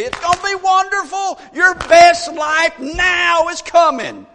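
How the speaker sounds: loud, high preacher hype; hype shout. Retold or imitated. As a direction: shout it out like a hyped-up prophet. Prophet hyping the king's victory.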